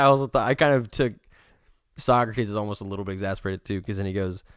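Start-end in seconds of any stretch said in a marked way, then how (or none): none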